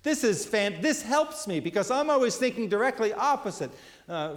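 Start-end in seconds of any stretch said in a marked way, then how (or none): 0.0-1.6 delighted reader. Retold or imitated. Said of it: "you read them and you're like".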